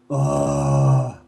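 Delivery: deep lazy groan as the head tips back; low contented groan. Retold or imitated. Rude man leaning back in the steam, low groan. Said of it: "Put his head back and go".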